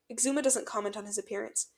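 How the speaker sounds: plain reading voice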